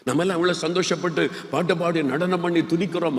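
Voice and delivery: usual speaking voice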